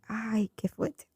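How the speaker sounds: soft, breathy, long-drawn sigh of words; retold stunned reaction. Woman reacting to boyfriend cutting her off, hushed retold dismay. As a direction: stretch it out under the breath.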